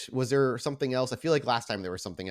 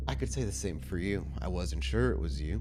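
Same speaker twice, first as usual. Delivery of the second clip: a low, controlled vampire lord issuing a threat; Maxwell; 6 semitones lower, 4 dB quieter than usual. Acted. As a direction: calmly threaten them like a prince who is not alone. Storyteller answers as Maxwell.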